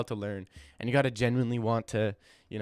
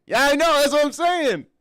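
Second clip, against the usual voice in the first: high, loud excited outburst; excited agreement; 13 semitones higher, 8 dB louder than usual. Real, not acted. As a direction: shout it, thrilled to be proven right. Excited at praise of his accent.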